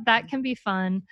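ordinary delivery

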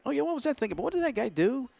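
idle curiosity at home; musing inner voice. Retold or imitated. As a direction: wonder it aloud to yourself, lifted high. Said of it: "I'm thinking".

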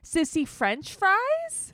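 high, drawn-out cooed question; slow mock baby talk. Imitating her offering the child food, slow baby talk. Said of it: "like, to a baby".

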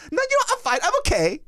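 very high, sing-song mock reassurance; hammed-up squeaky "all fine" act; smiling, giggly girlfriend. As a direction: imitate someone suddenly too cheerful. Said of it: "she starts smiling and laughing".

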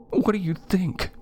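high-pitched, annoyed sister's retort; sarcastic, peeved comeback; grumbling. A narrator's reading. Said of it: "she grumbled".

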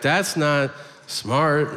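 slow, drawn-out correction; careful substitute phrase. Acted. Comedian forced to use polite wording, slow and stilted. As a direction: stretch out each word carefully. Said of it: "Now you gotta be like".

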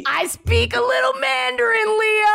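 high-pitched comic outburst; mock boasting shout. Host showing off Mandarin to the guest, mock boasting shout. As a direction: shout it in playful indignation.

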